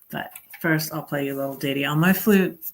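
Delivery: ordinary talk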